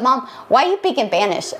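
"speak in Spanish" as "peak in Panish"; child's missing S's. Retold or imitated. Unscared son looks at mom and asks, "speak" as "peak". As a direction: drop the S, so "Spanish" becomes "Panish". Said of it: "he couldn't say his S's".

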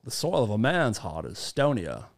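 put-on Maine old-timer accent, dropped r's; Judd. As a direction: intone the film line in a Maine accent. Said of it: "another line, Pet Sematary".

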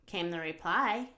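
usual speaking voice

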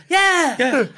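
usual speaking voice

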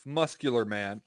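usual speaking voice

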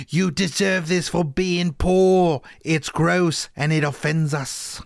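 slow, disdainful upper-class sneer; snooty Londoners. Retold or imitated. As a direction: look down your nose at the poor. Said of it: "everything outside of Whitechapel is like".

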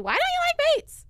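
hammed-up shout of a question; mock yell. Cohost playfully showing how she won't yell at her beet-hating friend, mock yell. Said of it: "not yell back at you".

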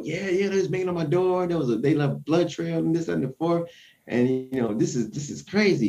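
high, excited neighbor voice telling the news; imitating the neighbor. Neighbor tells the news crew about the door banging, high and excited. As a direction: play the rattled neighbor talking to reporters. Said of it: "So she's like".

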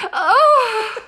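high-pitched delighted gush; excited. Real, not acted. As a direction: squeal with glee. Gushing over dolphin mucus.

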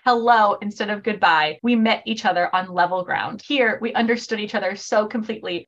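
low, soft, measured narration; literary quote reading. Acted. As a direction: read the passage tenderly, voice sinking toward the end. Reads a quote from the novel.

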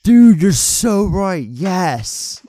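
high, loud and drawn out, easing on the last word; excited agreement. Real, not acted. Co-host is told he nailed the lookalike, excited agreement. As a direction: agree emphatically, stretching the words. Sudden agreement with co-host's comparison.

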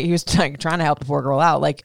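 ordinary talk

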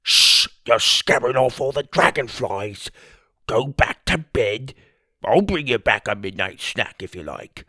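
lightly voiced sleepwalker shushing; dreamy Tim. A narrator's reading. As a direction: hush him, lost in a dream. Sleepwalking Tim hushing the boy.

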